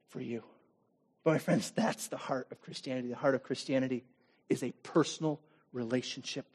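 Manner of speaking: quiet sincere preaching; intimate hush. Real, not acted. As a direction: drop to a near-private plea. Intimate sermon drop.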